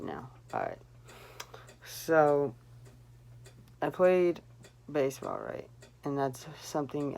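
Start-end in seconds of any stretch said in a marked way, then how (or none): none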